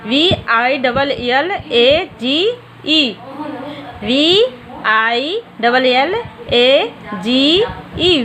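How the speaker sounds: each letter drawn out slowly; slow spelling. Shown as a demonstration. Teacher dictating a spelling to young students, slow and deliberate. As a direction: spell it out one letter at a time for the class. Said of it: "फिर आपका village".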